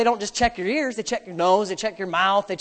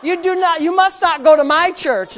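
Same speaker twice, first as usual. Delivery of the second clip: louder, laughing mock scold; laughing tease; 5 semitones higher, 6 dB louder than usual. Father teases son in the car, laughing. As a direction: scold him through laughter. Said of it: "is what I was saying".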